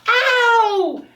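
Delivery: high, loud yelp of pain; wolf burning his foot on the fire; screamed ow. Acted. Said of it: "and he screamed".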